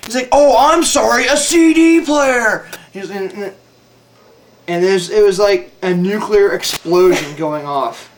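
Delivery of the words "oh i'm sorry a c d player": high, slow mocking sarcasm; sarcastic sergeant. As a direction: drag it out to mock him. Sergeant mocks his correction.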